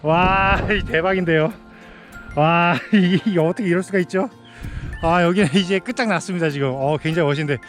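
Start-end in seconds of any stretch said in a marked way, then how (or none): none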